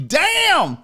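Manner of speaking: high, loud drawn-out damn; Damn shouted in amused disbelief; loud exclamation. Real, not acted. Reacting to a viewer's joke.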